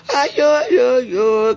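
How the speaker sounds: high, loud mock lament; wailing exclamation. Real, not acted. Streamer lamenting over the Bamileke, high wailing exclamation. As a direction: stretch "aïe yo yo" into a loud wail.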